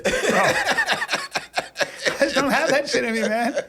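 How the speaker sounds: laughing through the words, fast. Real, not acted. Man admitting he can't hold grudges, laughing.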